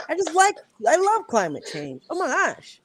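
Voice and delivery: high-pitched gushing sarcasm; sarcastic. Real, not acted. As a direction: gush in a high voice with mock enthusiasm. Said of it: "she's so sarcastic".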